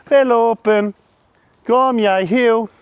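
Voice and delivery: loud, high call to come; sharp high recall. Real, not acted. Handler recalling his puppy, high and loud. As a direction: snap the dog back to you.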